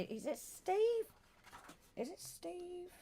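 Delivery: STEVE lifted high in a doubtful question. Real, not acted. Host unsure of a viewer's name, questioning. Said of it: "I, like, question".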